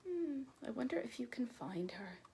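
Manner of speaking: hushed playful whisper. Acted. Teacher whispers to children about hide and seek. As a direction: whisper it like a secret game.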